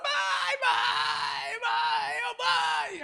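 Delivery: put-on high, crying shouts of a lost kid; desperate crying. Lost boy crying out for his mother, high desperate shouting. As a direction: call mom again and again, sobbing. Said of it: "comecei a chorar desesperado".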